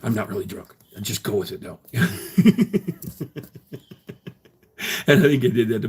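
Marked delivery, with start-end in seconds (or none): 0.0-1.8 whisper. Retold or imitated. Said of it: "I whisper in their ear". 1.8-4.3 breathy laugh. Real, not acted. Laughing after prank story.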